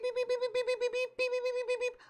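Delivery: very high, soft repeated beeps; imitated beeping. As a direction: squeak the beeps like a tiny alarm. Mocking the beep noise.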